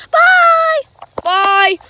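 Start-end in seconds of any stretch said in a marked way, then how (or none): 0.1-0.8 loud, very high squeal of a voice; Chica character. Pretend. Chica signs off to viewers, high squeaky voice. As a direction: pipe it up high and long. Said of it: "So, Chica, say bye".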